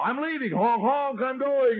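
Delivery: high-pitched mock call with spoken honks; announcing departure to everybody. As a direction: call out to the whole street, hammed-up. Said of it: "he tells everybody".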